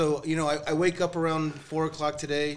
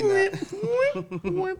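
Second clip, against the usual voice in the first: much higher, very slow, laughing; laughing squeal. Retold or imitated. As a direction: stretch it out laughing. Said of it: "I'm kinda like".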